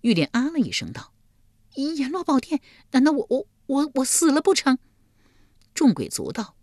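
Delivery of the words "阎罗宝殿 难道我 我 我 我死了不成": high, stammering, frightened woman; alarmed high-pitched stutter; shocked panic. A narrator's reading. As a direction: repeat words in rising disbelief. Shocked woman realises she is dead.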